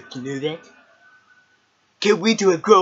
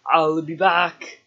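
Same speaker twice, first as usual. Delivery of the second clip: a light take on Arnold's catchphrase; Arnold impression; slower than usual. Kid doing Arnold for the camera, slow and lightly voiced. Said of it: "Start out with Arnold".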